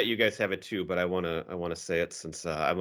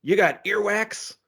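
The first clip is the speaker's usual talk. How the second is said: high-pitched imitation of a bellowing bank robber; mock tough yell; 9 semitones higher than usual. Podcaster mimicking a bank robber scaring hostages, mock yell. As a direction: yell it like a tough guy, half in fun. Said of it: "you hear him yell out".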